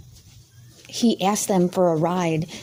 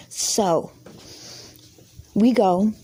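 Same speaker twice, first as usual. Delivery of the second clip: normal talking voice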